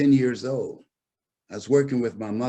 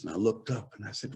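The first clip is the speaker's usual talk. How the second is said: normal talking voice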